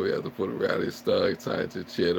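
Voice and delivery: ordinary talk, nothing marked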